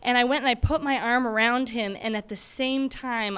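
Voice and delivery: ordinary talk, nothing marked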